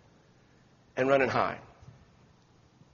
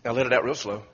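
guided breathing; 6 semitones lower, 4 dB quieter than usual. Shown as a demonstration. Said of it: "I want you to do".

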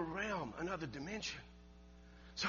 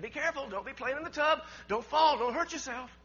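put-on raised, louder warning to children; a higher-pitched mock caution; cautioning woman; 8 semitones higher, 8 dB louder than usual. Woman warning the children in the tub, cautioning.

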